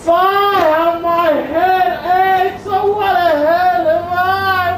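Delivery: slow, high, sing-song moaning; moaning complaint. Acted. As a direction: moan over an aching head. Nurse moans about aches.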